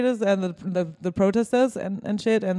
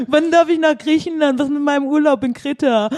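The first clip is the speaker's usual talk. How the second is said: higher, louder, a bit whiny and laughing; whiny tourist voice; 6 semitones higher, 5 dB louder than usual. Pretend. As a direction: beg for Crete like a spoiled tourist. Mocking German holiday-makers.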